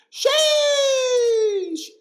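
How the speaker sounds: very high, piercing long yell; high-pitched shriek. Retold or imitated. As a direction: screech it out, imitating a kid's punchline. Acts out boy's sudden loud punchline.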